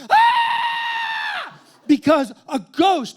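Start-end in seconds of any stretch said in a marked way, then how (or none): 0.1-1.6 sudden very high, loud shriek; hammed-up panic scream; mock terrified scream. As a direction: scream like a ghost just appeared. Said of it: "he goes".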